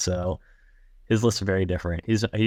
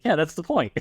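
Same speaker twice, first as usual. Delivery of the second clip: higher-pitched playful comeback; mock retort; 8 semitones higher than usual. Voicing the ska guys answering critics, mock retort. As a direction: toss it back as the obvious answer.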